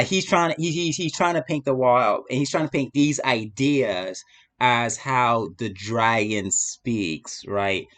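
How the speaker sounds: ordinary delivery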